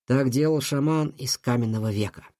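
ordinary delivery

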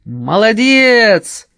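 loud drawn-out cheer; shout. A narrator's reading. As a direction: yell it slowly with joy. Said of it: "крикнула Грина".

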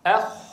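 teacher showing kha; khaa demo. Shown as a demonstration. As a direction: hold the خ in أخ full and heavy. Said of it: "قفوا معي على الخاء".

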